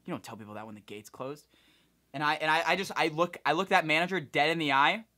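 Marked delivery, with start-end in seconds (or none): 0.1-1.5 hushed scolding, much softer; retold whisper. Manager scolding the gate agent, a soft hushed scold. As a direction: scold under the breath. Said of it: "whispers in her ear".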